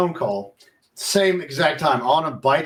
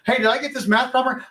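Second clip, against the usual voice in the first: much higher, a hint of a little girl asking; lightly voiced child; 9 semitones higher than usual. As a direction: mimic the daughter with her homework question. Daughter Vivian asks a question.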